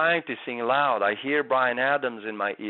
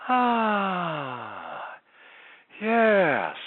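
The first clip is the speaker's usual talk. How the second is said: high, breathy and airy; comfortable breathy sound; 8 semitones higher than usual. Shown as a demonstration. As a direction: intimate breathy singing. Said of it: "that's a breathy sound".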